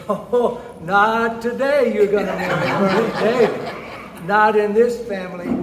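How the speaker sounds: loud, emphatic refusal; outraged father. Acted. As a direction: forbid it as a scandalized dad, raising your voice. Father forbids the marriage.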